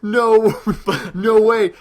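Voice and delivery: high, stunned crowd disbelief, hammed up; crowd gasp. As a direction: play the whole arena losing it. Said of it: "everyone's like".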